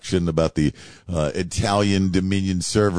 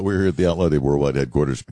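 ordinary talk, nothing marked

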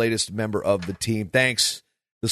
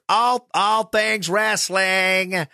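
slow, higher drawn-out Georgia accent, played badly; mock Georgia accent, much higher and slow; 9 semitones higher, much slower than usual. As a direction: ham up a Southern Georgia drawl on purpose. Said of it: "that's my bad Georgia accent".

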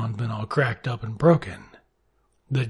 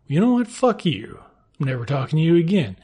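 high-pitched, loud opening that eases down; mock outraged outburst; 4 semitones higher, 4 dB louder than usual. Clara breaks with Esteban after the beating, mock outrage. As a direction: imitate an indignant wife telling him off.

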